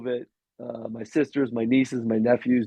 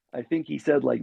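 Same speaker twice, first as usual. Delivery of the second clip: ordinary talk, nothing marked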